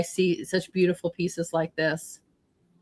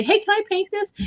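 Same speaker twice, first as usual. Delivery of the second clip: high, sweet asking voice, much higher; imitating Nancy; 14 semitones higher than usual. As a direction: mimic her polite, eager request. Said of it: "I love that you reach out and say".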